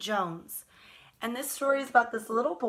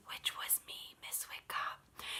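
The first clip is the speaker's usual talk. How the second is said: soft whispered aside; 14 dB quieter than usual. Real, not acted. Storyteller whispers an aside to the children, hushed. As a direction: drop to a whisper for the secret. Aside while telling about her birth.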